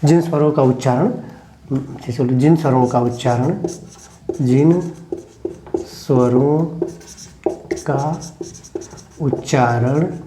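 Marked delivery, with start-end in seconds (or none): 4.4-10.2 slow, each word set apart; dictation. Shown as a demonstration. Teacher dictating to pupils who write, word by word.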